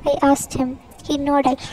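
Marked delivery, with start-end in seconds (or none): none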